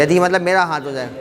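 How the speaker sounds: normal talking voice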